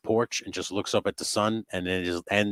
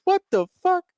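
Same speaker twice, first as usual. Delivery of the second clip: shrill high squeal of disbelief, much higher and louder; impersonating a stunned moviegoer; mock shocked yell; 5 dB louder than usual. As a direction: squeak it out in outrage. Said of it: "He goes".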